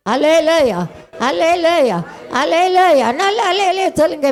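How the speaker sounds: preacher prompting a response; rousing call. Real, not acted. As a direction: call it out to stir the congregation.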